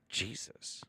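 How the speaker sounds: breathed exasperated aside; whispered Jesus. Real, not acted. Streamer sighing to himself in a whisper. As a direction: murmur it under your breath.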